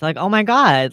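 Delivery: ordinary talk, nothing marked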